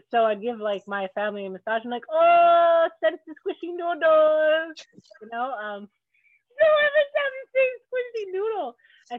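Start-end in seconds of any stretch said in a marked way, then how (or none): 2.1-4.8 much higher, louder, slow crooning; hammed-up family massage bit; spicy noodle massage voice. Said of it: "we call it the spicy noodle massage". 5.8-8.7 much higher, cracking up through the words; giggling helplessly at the joke; laughing. Real, not acted. Friend cracks up at the bit.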